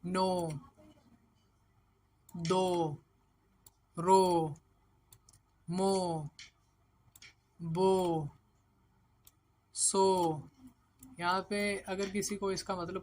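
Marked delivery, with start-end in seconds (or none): 0.0-10.0 नो, दो, रो, मो, बो, सो each held with long "oh"; syllable drill. Shown as a demonstration. Teacher joins consonants with ओ, drawn-out नो to सो. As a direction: chant out नो, दो, रो, मो, बो, सो separately, long pauses between. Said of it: "ओ के साथ मिलेगा तो".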